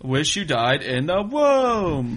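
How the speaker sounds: loud, slow, swooping mimicry; mocking weird inflection. Wrestling fan mimicking two wrestlers' speech pattern, hammed-up wrong inflection. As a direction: drag it out, rising and falling in odd places. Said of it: "their speech rises up and down".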